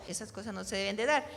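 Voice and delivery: ordinary talk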